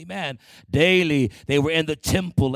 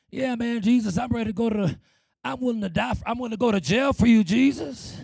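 higher, eager promise, tumbling out fast; eager disciple; 5 semitones higher, faster than usual. Acted. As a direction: play Peter boasting of his loyalty. Acting out Peter's boast to Jesus.

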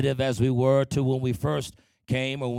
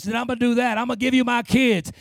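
higher, insistent acted vow; desperate bargaining; 6 semitones higher, faster than usual. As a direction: pile up promises to God like a pleading believer.

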